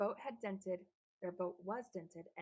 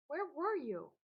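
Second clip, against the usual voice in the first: demanding question; 7 semitones higher, 6 dB louder, slower than usual. A narrator's reading. Frederick demands an answer.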